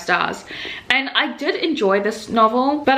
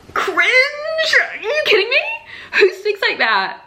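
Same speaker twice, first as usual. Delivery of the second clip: high-pitched, laughing disbelief; incredulous cringing outburst; slower than usual. Real, not acted. Reader reacting to a cheesy romance line, laughing disbelief. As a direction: squeal it out, stretched, through a laugh.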